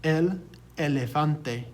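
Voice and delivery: elefante as eh-leh-FAHN-tay, slowly; slow El elefante, stressed on FAN; Spanish pronunciation. Shown as a demonstration. Teacher has students repeat the example word, slow demonstration. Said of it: "Repeat after me".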